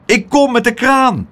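high, urgent call. A narrator's reading. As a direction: call out that rescue is coming. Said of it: "riep Pluk".

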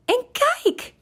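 hoge, enthousiaste kikkerroep; opgewonden uitroep. A narrator's reading. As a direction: roep je vriend hoog en verwonderd toe. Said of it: "riep Kikker". (enthousiast, hoog roepend)

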